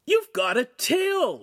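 high, drawn-out exclamation; shouted. A narrator's reading. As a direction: cry it out high and slow in astonishment. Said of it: "Lincoln shouted".

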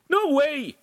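high, loud refusal; defiant refusal. A narrator's reading. Apprentice rejects advice to apologise, loudly defiant. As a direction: refuse point-blank, pitched right up.

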